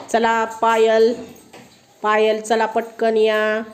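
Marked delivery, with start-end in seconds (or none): none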